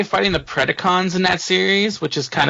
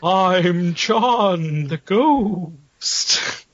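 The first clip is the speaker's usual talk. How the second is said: spooky voice; 4 semitones higher, much slower than usual. Pretend. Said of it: "I can't do a spooky voice".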